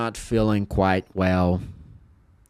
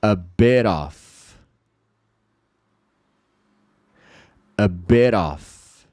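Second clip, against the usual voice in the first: drawn out A bit off for repetition; spaced out repeat-after-me A bit off; slow drill; much slower than usual. Shown as a demonstration. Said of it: "Fala comigo em voz alta".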